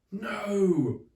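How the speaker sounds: high loud defeated cry; villain's shout. Acted. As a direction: yell as he's beaten. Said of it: "shouted Billy William".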